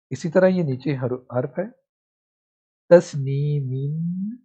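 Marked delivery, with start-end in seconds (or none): 2.9-4.4 تسنیمین read very slowly, every syllable stretched to the end; drawn-out full reading. Shown as a demonstration. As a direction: spell out تسنیمین slowly, tanween included. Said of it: "جب یہاں پر وقف کریں گے". (تسنیمین کو بہت آہستہ کھینچ کر پورا پڑھیں)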